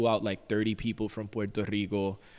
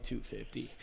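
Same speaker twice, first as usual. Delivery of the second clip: quiet; 12 dB quieter than usual. Retold or imitated. Muttering the price in shock.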